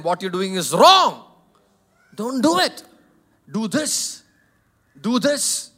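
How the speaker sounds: loud, firm correction; firm parental scolding. Retold or imitated. Pastor voicing himself correcting his children loudly. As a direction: raise your voice at the kids.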